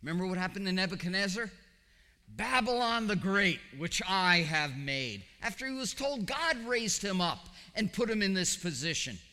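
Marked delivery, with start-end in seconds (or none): 2.3-5.2 loud, swelling boast of a tyrant king; proud king boasting. Acted. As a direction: proclaim it like a vain king. Nebuchadnezzar boasting, quoted.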